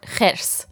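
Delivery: Khers with a brief, clipped final s; short final S. Shown as a demonstration. Teacher correcting a student, Khers with a short final S. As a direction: cut the s at the end of Khers short. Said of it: "Just make the last, the S sound short".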